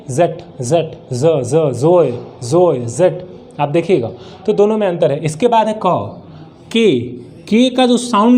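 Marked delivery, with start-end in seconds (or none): 0.1-3.3 "जेट", "ज", "जोए" begun with a buzzing z, lower; z sound. Shown as a demonstration. Teacher contrasting it with Hindi j, z sound. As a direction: say the ज in "जेट" and "जोए" as z, drilled one by one. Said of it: "ये ज नहीं है".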